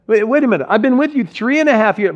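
gently mock their late belief; sanctified sarcasm. Jesus teasing his excited disciples, lightly sarcastic. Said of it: "it sounds a little bit like this".

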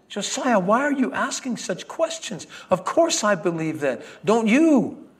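rising up in surprised protest; startled Sarah. Acted.

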